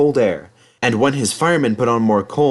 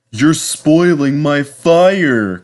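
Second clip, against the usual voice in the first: slow, raised, aggrieved engine voice; complaining; 5 semitones higher, 5 dB louder, much slower than usual. Acted. Henry to his fireman, sulky and complaining slowly. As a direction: whine it like a put-out engine. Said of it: "complained Henry".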